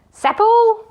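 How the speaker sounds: high, loud bellow of a name; shouted name. A narrator's reading. Magician shouting for his servant, loud bellow. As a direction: shout for the servant across the castle. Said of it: "he shouted".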